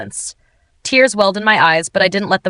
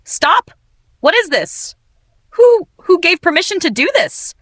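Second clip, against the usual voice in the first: shaky outcry; 10 semitones higher than usual. A narrator's reading. Heroine confronting the demolition foreman, shaking outcry. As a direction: cry out in panic, voice shaking. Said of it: "my voice shaking".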